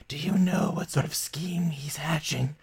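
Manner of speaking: conspiratorial whisper; the devil tempting the party, in a whisper. Acted. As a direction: drop to a whisper for the hook. Devil baiting, hushed.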